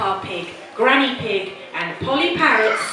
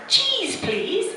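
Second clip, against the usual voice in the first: Grumpy Rabbit; 12 semitones higher than usual. Acted. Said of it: "said Grumpy Rabbit".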